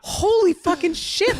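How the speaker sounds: high, loud, drawn-out astonishment; hammed-up shock; shocked exclamation. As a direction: exclaim it slowly in amused disbelief.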